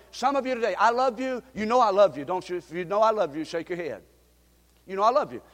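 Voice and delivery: ordinary talk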